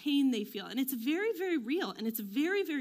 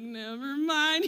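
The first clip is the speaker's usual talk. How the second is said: slow, louder drawn-out retreat; caught out; 3 semitones higher, 5 dB louder, slower than usual. Retold or imitated. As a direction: stretch it out like someone caught red-handed. Acts out being caught, backing off.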